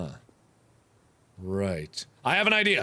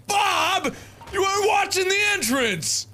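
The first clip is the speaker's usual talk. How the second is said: high exasperated shout; 11 semitones higher than usual. Real, not acted. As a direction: yell at your teammate. Yelling at teammate.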